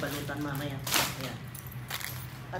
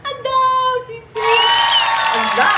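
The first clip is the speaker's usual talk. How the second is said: excited shout; 12 semitones higher, 9 dB louder than usual. Real, not acted. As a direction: shout in amazement. Shouting at money.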